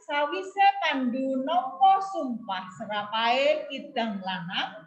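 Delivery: slow, careful model reading; unhurried, clear. Shown as a demonstration. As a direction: read the story slowly and distinctly. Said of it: "Nggak cepet-cepet tapi jelas ya".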